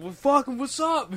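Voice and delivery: high, cocky mock challenge; mock tough buddy. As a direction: toss it off like a hothead. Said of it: "He's like".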